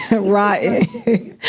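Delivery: ordinary talk, nothing marked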